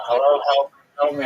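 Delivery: hammed-up shout for help from a trapped woman; near-screaming plea. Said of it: "kind of, like, border screaming".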